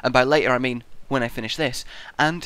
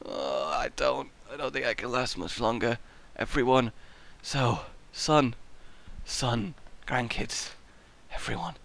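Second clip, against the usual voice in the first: much softer, slow, faded deathbed voice; hammed-up frail farewell; feeble dying voice; 3 semitones lower, 11 dB quieter than usual. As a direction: fade out like an old man dying. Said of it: "I'm like".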